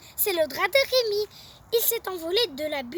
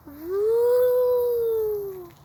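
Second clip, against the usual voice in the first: long high ghostly wooo; ghost wail; 5 semitones higher, 5 dB louder than usual. Acted. Ghost howling outside the classroom, long wail.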